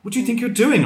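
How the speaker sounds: high, sharp shouted question. A narrator's reading. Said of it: "I shouted".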